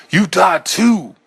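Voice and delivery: high, loud, slow howl; savage howl. A narrator's reading. Man stabbing the eye on his hand, savage howl. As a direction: howl it as you stab the eye. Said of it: "I howled at it".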